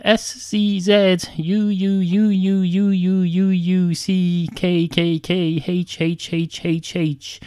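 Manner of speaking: slow, deliberate letter-by-letter spelling; drawn-out spelling. Shown as a demonstration. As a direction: labour through every repeated letter slowly. Spelling out an absurdly long word.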